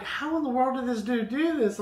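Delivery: high-pitched retold amazement; mildly hammed-up disbelief; incredulous. Retold amazement at neighbor's fix.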